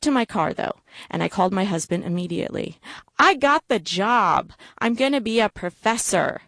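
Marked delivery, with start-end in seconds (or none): 3.2-6.4 thrilled, higher-pitched and stretched out; excited announcement. Acted. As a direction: announce big news proudly to your partner. Excited phone call to husband.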